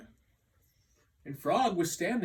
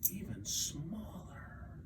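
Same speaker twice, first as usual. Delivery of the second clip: whisper; 9 dB quieter than usual. A narrator's reading. Narrating Frog shrinking.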